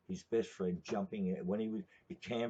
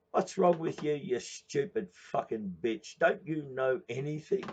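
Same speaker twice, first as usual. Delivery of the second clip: higher, louder scornful snap; mock angry retort; 7 semitones higher, 6 dB louder than usual. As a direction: bark it back at her, all contempt. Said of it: "He says".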